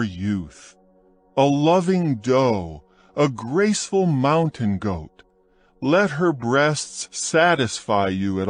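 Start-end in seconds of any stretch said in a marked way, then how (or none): none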